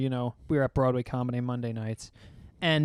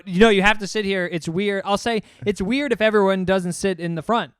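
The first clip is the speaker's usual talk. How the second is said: louder, higher insisting; insistent host; 4 semitones higher, 6 dB louder than usual. Retold or imitated. To guests who won't sit up front, a loud insistent plea. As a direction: press them to sit up front. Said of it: "I go".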